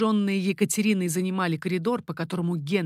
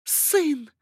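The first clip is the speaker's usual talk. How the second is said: high-pitched cry; 7 semitones higher than usual. A narrator's reading. Mother calls from window to her son, crying out. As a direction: call out high, with moderate volume. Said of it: "крикнула Екатерина".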